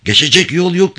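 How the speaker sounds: high-pitched, alarmed call. A narrator's reading. Driver warns Craig the way is blocked, calling out. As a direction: call out a warning from the wheel. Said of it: "diye seslendi Salien".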